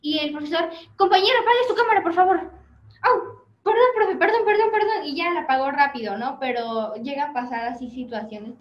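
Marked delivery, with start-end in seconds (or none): 1.0-2.4 a loud, quick teacher's order; teacher telling a student to turn off the camera; stern teacher. Retold or imitated. 3.0-5.1 loud, high, embarrassed sorry; student apologizing to the teacher; flustered apology. Retold or imitated.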